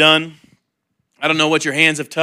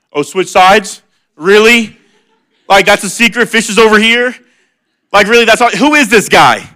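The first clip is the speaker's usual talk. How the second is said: higher and louder, mock disbelief; incredulous Peter; 5 semitones higher, 4 dB louder than usual. Tired Peter scoffing at the stranger's fishing advice, incredulous. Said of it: "you gotta imagine Peter's like".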